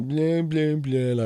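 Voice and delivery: high, airy mock chatter; mocking babble of a woman on the phone. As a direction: parody her yapping on the phone. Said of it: "the woman's just like".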